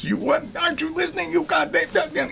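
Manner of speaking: very high, strained imitation of a yelling customer; mock raving yell. Plumbing customer raving at the clerk, mock yelling. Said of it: "and he yells".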